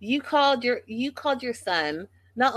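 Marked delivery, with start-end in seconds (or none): none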